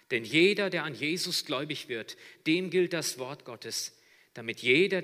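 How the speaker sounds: loud, high, soaring sermon voice; full-force preaching. Real, not acted. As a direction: build the scene with full preaching power. Preaching burst. (predigen mit voller Kraft)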